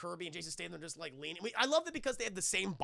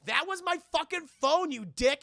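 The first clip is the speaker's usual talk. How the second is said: angry yell; 8 semitones higher, 7 dB louder than usual. Retold or imitated. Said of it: "And The Rock's like".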